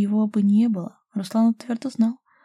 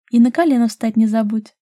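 louder, playful reminder; teasing; 3 semitones higher, 8 dB louder than usual. A narrator's reading. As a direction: call it teasingly after him. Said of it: "донеслось смешливое в спину". (смешливо, вдогонку)

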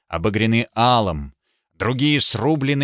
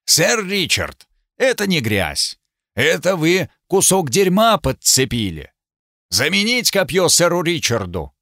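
commanding; 5 semitones higher than usual. Acted. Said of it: "сказал властно".

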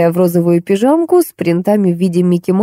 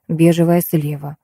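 usual speaking voice

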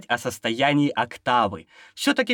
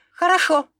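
crisp reply; 13 semitones higher, 4 dB louder than usual. Acted. Cadet answers commander.

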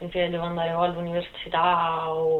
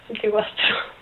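laughing; 4 semitones higher than usual. Real, not acted. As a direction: laugh through it, a bit embarrassed. Laughs repeating her age. (ridendo mentre ripete l'età)

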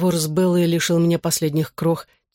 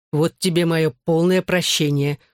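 ordinary delivery, nothing marked

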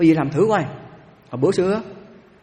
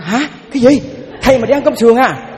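higher and louder, aghast outcry; shocked yelling; 8 semitones higher, 7 dB louder than usual. Retold or imitated. Waitress yells at the monk ordering pork ribs, shocked and loud. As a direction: yell it out in shocked disbelief. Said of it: "Cái nó la lên cái gì?".

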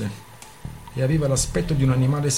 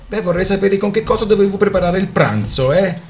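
higher, louder angry retort; 9 semitones higher, 8 dB louder than usual. Acted. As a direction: throw it back at him, indignant. Couple quarrelling over dinner.

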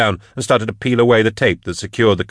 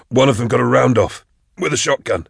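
higher, slightly hoarse voice; hoarse from vomiting; 5 semitones higher than usual. A narrator's reading. Drusha reporting after being sick, hoarse. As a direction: speak up through a raw throat. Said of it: "His voice was hoarse from vomiting".

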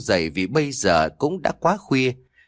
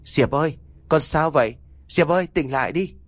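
lightly acted higher-pitched calling; worried father calling; 4 semitones higher than usual. A narrator's reading. Father calls Diệp back to consciousness, mildly urgent calling. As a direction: call gently to rouse someone, pitch lifted. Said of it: "Tiếng của bố tôi gọi bên tai".

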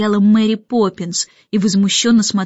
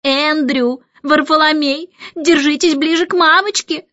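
calling out; 6 semitones higher than usual. A narrator's reading. Lady calling her dogs.

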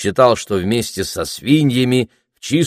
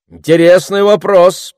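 raised, louder public-speaker voice; orator's pronouncement; 11 semitones higher, 7 dB louder than usual. A narrator's reading. Orator weighing a question before the crowd, pronouncing it loudly. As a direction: answer out loud for the whole crowd. Оратор отвечает на вопрос из толпы.

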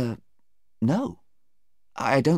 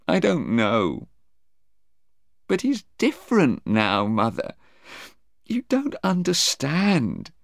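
plaintive, mostly higher voice; a touch of upset, halting where words fail; tearful; 9 semitones higher than usual. A narrator's reading. Sarah confiding her doubts to her mother, tearful. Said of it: "Sarah began to cry again".

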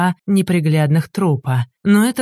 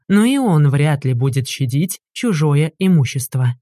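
usual speaking voice

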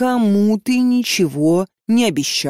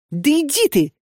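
much higher furious shout; angry outburst; 9 semitones higher than usual. A narrator's reading. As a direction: fling it at him as you break away. Katya breaks free shouting.

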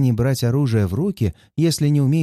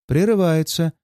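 usual speaking voice

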